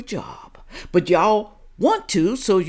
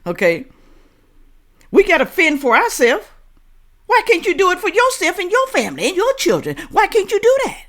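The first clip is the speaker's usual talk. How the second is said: louder, higher, exasperated outburst; indignant outburst; 10 semitones higher, 5 dB louder than usual. Real, not acted. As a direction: fire back at them, fed up. Arguing back at the clip.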